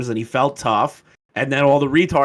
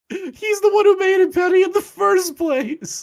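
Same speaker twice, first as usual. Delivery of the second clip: laughing. Real, not acted. Laughing at clip's claim.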